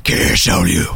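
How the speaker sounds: low gravelly Batman growl. Pretend. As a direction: growl it like the Dark Knight. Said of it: "I'm Batman".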